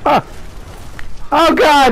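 panicked yell. Real, not acted. Panicked in game fight.

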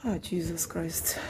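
whispered despair aside; whispered exasperation. Real, not acted. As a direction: breathe it out under your breath. Exasperated aside during bad call.

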